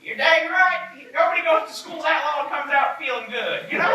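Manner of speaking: put-on high-pitched old woman agreeing from the cabin. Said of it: "an old woman".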